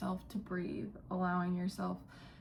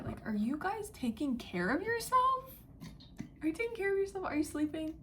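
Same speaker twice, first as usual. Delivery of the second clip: concerned high voice; 9 semitones higher than usual. Real, not acted. Caring check-in to viewers.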